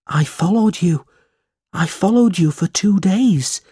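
put-on a desperate runaway boy clinging to his only friend; runaway boy begging to stay, high and pleading; pleading boy Smike. Narrator voices Smike.

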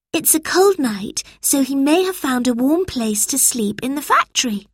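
put-on high child's voice, suggesting; thoughtful girl. A narrator's reading. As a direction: offer an idea to your friends.

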